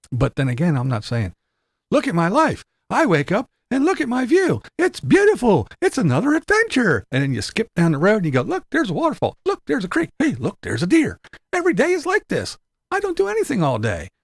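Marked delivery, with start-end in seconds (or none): 1.9-7.0 high, bubbly mock-cheerful voice; mock influencer gush. Mocking a cheerful van-life influencer. 8.4-14.1 high, bubbly mock-cheerful voice; mock influencer gush. Mocking carefree van-lifer skipping along.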